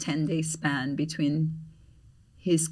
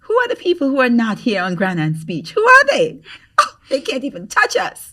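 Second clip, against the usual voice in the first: higher, louder gloating; mock boast; 6 semitones higher, 9 dB louder than usual. Teasing absent friends from the beach, mock boast. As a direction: brag like nobody can touch you. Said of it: "we're like".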